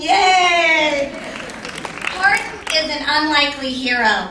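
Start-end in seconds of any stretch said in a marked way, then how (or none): 0.0-1.1 mock cheer. Cheering for Horton.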